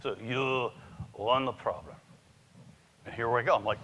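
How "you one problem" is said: put-on Yasu's Japanese accent. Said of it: "So I'm gonna do Yasu's voice".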